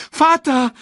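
very high, loud cry; panicked call. A narrator's reading. Simba sees his father swept away, crying out. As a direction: cry out after a falling father. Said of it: "rief Simba".

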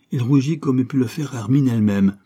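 ordinary delivery, nothing marked

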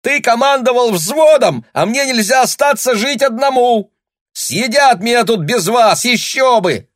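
high, loud, sarcastic crowing yell; rooster voice. Acted. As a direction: shout back like a cocky rooster. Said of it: "петушиным голосом закричал вслед".

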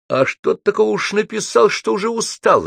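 high-pitched challenging comeback; curt retort. A narrator's reading. Аксаков cuts off Добролюбов's complaint, curt retort. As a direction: throw the complaint back at him. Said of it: "отрезал Аксаков".

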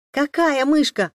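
high, loud, drawn-out astonishment; amazed exclamation. A narrator's reading. Amazed twins exclaim in chorus at papa's news, astonished. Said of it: "хором воскликнули изумлённые двойняшки".

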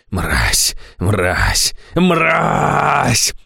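furious, rising to a high yell; yelling in rage. A narrator's reading. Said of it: "орал он".

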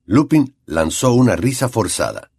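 ordinary delivery, nothing marked

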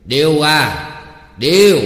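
high, loud shout of a name; calling out. A narrator's reading. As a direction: holler for someone out of sight.

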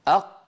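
أق with a hard closed qaf stop; stopped qaf. Shown as a demonstration. Teacher demonstrating أق with a closed stop for students. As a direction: say أق with the qaf fully shut, no release. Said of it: "أيوة، جربوا هكذا".

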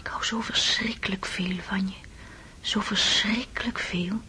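breathless loving whisper; tender unvoiced whisper of love; intimate whisper. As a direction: whisper it close to his ear. Wife whispers love to husband.

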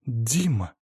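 mildly shocked gasp of a name. A narrator's reading. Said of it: "ахнула она". (тихо ахнув)

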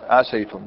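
"عسيتم" with fatha on the seen, "asaytum"; "عسيتم" with an open "ay" after the seen; preferred fatha reading. Shown as a demonstration. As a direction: say "عسيتم" as a-SAY-tum. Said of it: "الأفضل الفتح".

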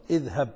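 "اذهب" begun with a clear glottal i, as iðhab; starting hamza. Shown as a demonstration. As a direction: open "اذهب" with a spoken hamza. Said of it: "لو بدأت بها ستبدأ بهمزة".

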